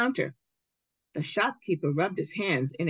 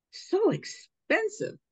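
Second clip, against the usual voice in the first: shocked father; slower than usual. Acted. Father balks at the price.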